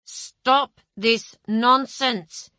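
slow, gritted-teeth anger; through clenched teeth. Acted. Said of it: "he said through clenched teeth".

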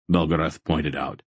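ordinary delivery, nothing marked

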